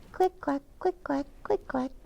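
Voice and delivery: high, soft clicky shoe sounds; light high footstep noise; click clack of heels. Acted. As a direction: tap out the heels with your voice.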